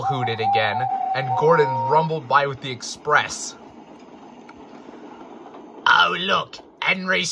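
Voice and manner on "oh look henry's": higher, drawn-out taunt; teasing freight car. A narrator's reading. A freight car teases frightened Henry, mocking.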